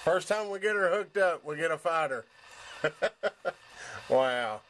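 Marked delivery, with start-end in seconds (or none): none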